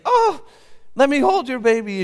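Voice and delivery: very high, loud, gushing; delighted. Acted. Papa asking to hold the baby, very high and delighted. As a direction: melt over the baby.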